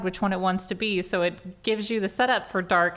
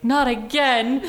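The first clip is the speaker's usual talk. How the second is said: drawn-out, louder, higher protest; mock exasperation; 5 semitones higher, 5 dB louder, much slower than usual. Film character cornered by yet another threat, mock exasperated. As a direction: groan it out slowly as a retold complaint.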